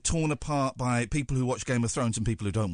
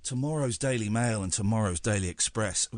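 usual speaking voice

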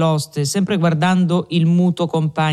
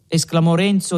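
ordinary delivery

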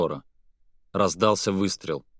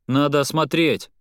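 urgent call; 7 semitones higher, 5 dB louder than usual. Acted. Petr rushes to wounded Kai.

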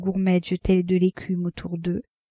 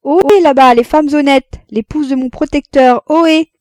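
loud, high hail across the crowd; crying out; 7 semitones higher, 10 dB louder than usual. A narrator's reading. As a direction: call out mockingly to the distant ladies. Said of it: "elle s'écria". (s'écrie fort et aigu, par provocation)

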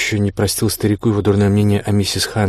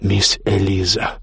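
ominous tone; much slower than usual. A narrator's reading. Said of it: "сказал я тоном, от которого она вздрогнула".

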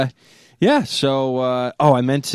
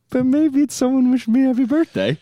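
hopeful voice; 13 semitones higher than usual. Retold or imitated. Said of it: "I was like".

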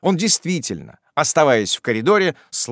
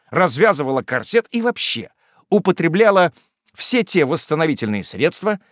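ordinary delivery, nothing marked

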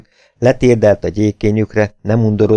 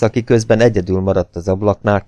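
usual speaking voice

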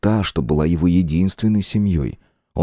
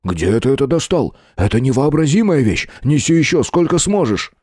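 roaring; 8 semitones higher than usual. Acted. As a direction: roar it with wild greed. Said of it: "заревел".

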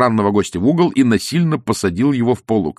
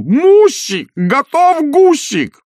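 high, loud, slow wail; yelling; 7 dB louder, much slower than usual. Acted. Hungry husband howling at his wife about the goose. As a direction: howl it out, unable to hold back. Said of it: "не в силах сдержать себя завопил".

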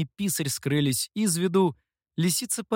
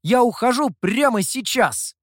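loud, high-pitched declaration; defiant raised voice; shouting; 8 semitones higher, 5 dB louder than usual. A narrator's reading. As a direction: announce it in an angry cry. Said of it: "снова закричал Сяо Ту".

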